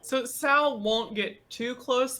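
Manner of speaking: usual speaking voice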